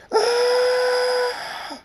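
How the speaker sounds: very high, loud, drawn-out scream; even bigger scream. A narrator's reading. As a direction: scream harder, in agony. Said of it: "Stardust screams ev-, e-, even more".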